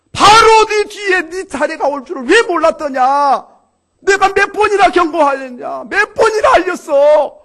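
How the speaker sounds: 높고 크게 꾸짖는 외침; 왜 몰랐냐고 몰아붙이며 호통쳐라; 염라대왕의 호통. Acted. (염라대왕이 젊은이를 크게 꾸짖는 호통)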